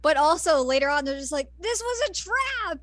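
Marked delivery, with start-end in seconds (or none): 1.6-2.8 much higher, slow fake surprise; hammed-up realization; mock shocked cry. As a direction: gasp it out in cartoon shock. Voicing surprised heroes.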